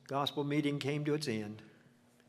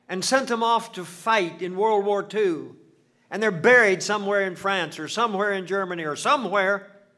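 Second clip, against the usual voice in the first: loud, rising preacher's build over every line; fervent preaching; 6 semitones higher, 7 dB louder than usual. Acted. Preacher pleads with the grieving mother about lost children, at full force. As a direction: hammer each never home to the congregation.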